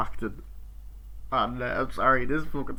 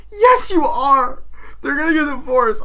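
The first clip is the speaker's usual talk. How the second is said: high, loud mock shouting; imitated shouted accusation; acted quarrel yell; 13 semitones higher, 9 dB louder than usual. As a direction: fire back in a hammed-up family argument. Acted quarrel between siblings.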